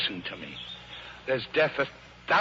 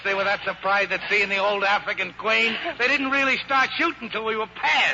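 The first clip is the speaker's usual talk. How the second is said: higher, brighter excited talk; elated praise; 8 semitones higher than usual. Acted. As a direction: rave about how well she did. Praising her handling elatedly.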